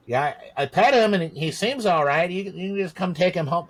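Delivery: high, breezy dismissive voice; mocking careless vet. Lazy vet to owner, breezy high mock dismissal. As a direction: play a lazy vet shrugging it off.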